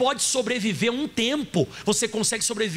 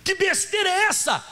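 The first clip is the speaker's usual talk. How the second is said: pitched-up angry cry; indignant shout; 8 semitones higher than usual. Acted. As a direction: shout it like a rebuke from heaven. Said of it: "Olha o que ele falou pra Agar".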